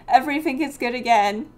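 ordinary talk, nothing marked

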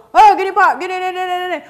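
overconfident sales pitch, loud and high. Acted. Salesman overdoing confidence at a customer, overconfident. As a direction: blurt it out far too loud and high, hammed-up. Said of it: "Atau terlalu pede".

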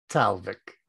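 normal talking voice

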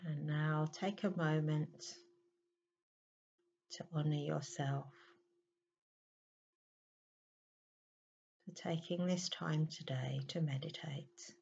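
unhurried, settling voice, leaving space to breathe; guided meditation. Real, not acted. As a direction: ease the listener into stillness, phrase by phrase.

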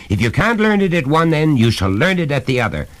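angry, insistent scolding; shouting father. A narrator's reading. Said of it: "shouted his father after him".

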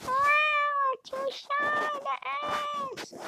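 "too shy to ask" squeaked high and slow. Shown as a demonstration.

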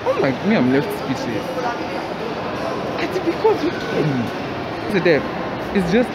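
muffled behind face covering; speaking with mouth covered; through a cloth mask. Real, not acted.

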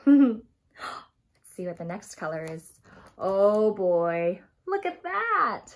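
usual speaking voice

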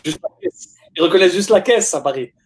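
high, laughing joke. Real, not acted. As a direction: tease through laughter, voice up high. Joking that Paris recognises only cars.

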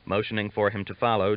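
plain reading voice

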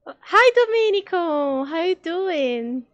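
high, loud hello with drawn-out ending; effusive greeting. Real, not acted. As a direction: greet them big and sing-song. Cumprimenta alguém com efusão. (saudação efusiva, aguda e alta)

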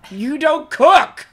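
loud, slow mock scolding; teasing shout. Real, not acted. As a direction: scold them loudly, drawn out. Couple teasing while playing game.

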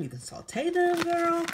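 high, excited list item. Real, not acted. Haul host listing shrimp recipes to viewers, excited. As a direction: let the enthusiasm climb high. Listing uses with rising enthusiasm.

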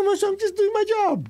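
high-pitched defensive reply to his teacher; protesting to the master. Retold or imitated. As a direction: squeak it out like an innocent student. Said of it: "I go".

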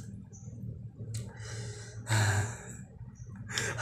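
long unvoiced sigh of defeat; weary sigh. Real, not acted. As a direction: breathe out like you're giving up. Tired of eating weird noodles.

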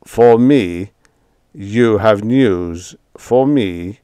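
slow surprised vampire. A narrator's reading. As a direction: halt and wonder aloud.

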